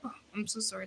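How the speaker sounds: normal talking voice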